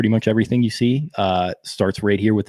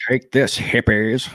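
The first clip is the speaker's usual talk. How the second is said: mock Nixon; slower than usual. Continuing Nixon game voice.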